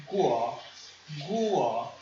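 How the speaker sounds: "Guo" repeated, climbing in pitch; rising second tone. Shown as a demonstration. Teacher repeating for learners, "guo" in a rising tone. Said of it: "está subindo".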